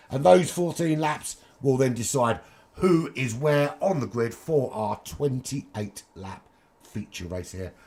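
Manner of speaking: usual speaking voice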